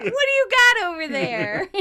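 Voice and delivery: high, loud mock-theatrical call; playful stage quote. Host saying it to the dogs, loud hammed-up call. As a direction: holler it playfully like a stage line. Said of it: "We do say that to the dogs".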